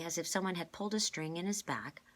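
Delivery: usual speaking voice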